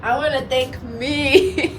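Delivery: playful proud call; proud shout-out. Real, not acted. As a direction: thank yourself with pride. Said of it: "Shout out to myself".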